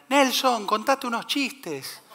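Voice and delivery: high mock call from the crowd; heckler's call without real volume; imitated audience shout. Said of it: "me gritan".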